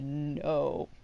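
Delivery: soft, low, horrified no; quietly said. Retold or imitated. Teacher staring at student in horror, quiet low no. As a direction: let it fall out almost silently. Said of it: "Quietly said".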